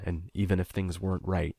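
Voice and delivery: ordinary delivery, nothing marked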